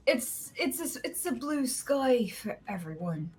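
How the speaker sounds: put-on drunk boss rambling. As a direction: play the drunk boss waxing lyrical before paying. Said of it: "and he'd be like".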